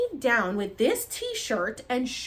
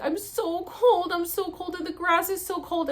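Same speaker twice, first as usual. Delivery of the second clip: cold whining; 9 semitones higher than usual. Retold or imitated. Patient complaining to helpers about cold grass, high acted whine. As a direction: whimper it as if chilled, re-enacted.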